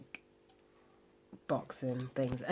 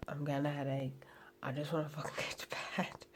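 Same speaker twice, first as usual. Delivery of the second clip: weary whisper; tired hushed complaint; 5 dB quieter than usual. Real, not acted. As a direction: whisper it like you're exhausted. Tired, late night, talking quietly.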